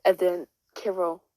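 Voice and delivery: ordinary delivery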